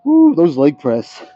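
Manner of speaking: slow, winded and breathy; out of breath. Real, not acted. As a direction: push words through heavy breathing. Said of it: "I am still trying to catch my breath".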